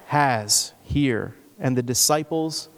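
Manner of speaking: ordinary talk, nothing marked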